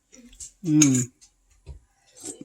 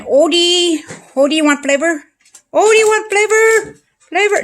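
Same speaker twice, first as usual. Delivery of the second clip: high, louder sing-song to the dog; high cooing dog talk, louder; pet talk; 12 semitones higher, 5 dB louder than usual. Real, not acted. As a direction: tease the dog with a treat. Calling the dog with treats.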